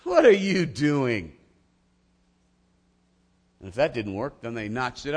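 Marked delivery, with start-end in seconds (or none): 0.0-1.3 scoffing. Retold or imitated. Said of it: "they scoffed contemptuously".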